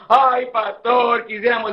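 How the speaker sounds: long, dragged-out mock lament; mock tearful wail. Congregation pleading with their former pastor, mock weeping. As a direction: stretch the cry out, imitating crocodile tears. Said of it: "no sea un cocodrilo llorón".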